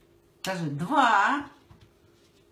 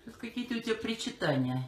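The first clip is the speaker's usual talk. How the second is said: ordinary talk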